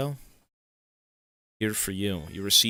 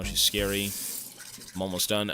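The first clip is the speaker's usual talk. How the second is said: usual speaking voice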